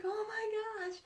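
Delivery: high, dismayed "oh my gosh"; sudden alarm at the situation. Retold or imitated. Mom realizing it's already coming, high alarmed gasp. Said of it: "And I'm like".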